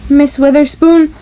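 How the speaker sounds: usual speaking voice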